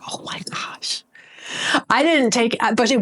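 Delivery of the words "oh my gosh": breathless shocked whisper; whispered oh my gosh. Retold or imitated. Telling viewers about the rude lady, shocked whisper. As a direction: hush it out in disbelief.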